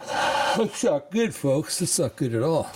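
high, slow sing-song tut-tut; mock dismay. Real, not acted. Host scolding the news for his viewers, high mocking singsong.

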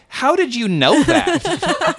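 high, slow, baffled; mock bewildered. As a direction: play it wide-eyed and innocent. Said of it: "And it's like".

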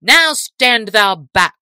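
slow, loud soldier's order; crying out. A narrator's reading. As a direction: shout to push him back. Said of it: "cried one of the men-at-arms".

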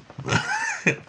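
short very high laughing squeal; high breathy giggle burst. Real, not acted.

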